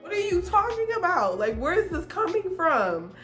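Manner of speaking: high-pitched, incredulous retort; indignant protest. Retold or imitated. Girlfriend answers boyfriend's accusations, indignant retelling.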